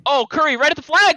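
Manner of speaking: high laughing yell; excited shout. Real, not acted. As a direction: shout the big play, delighted. Big play, laughing shout.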